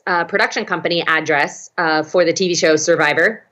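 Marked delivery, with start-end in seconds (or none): none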